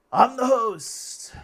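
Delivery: high, slow, loud shout. Acted. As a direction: shout it out proudly, slowly. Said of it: "It says".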